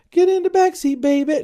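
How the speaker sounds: very high, loud mock singer voice; imitated singer. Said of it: "in the song, he's like".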